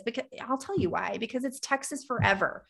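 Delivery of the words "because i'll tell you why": ordinary delivery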